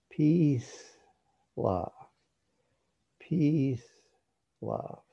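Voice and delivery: one word to each breath, long pauses; breath-paced mantra. Shown as a demonstration. Teacher demonstrating words for meditation, breath-paced. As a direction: let each word ride a breath, second word falling. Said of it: "a one syllable word on the in breath and another on the out".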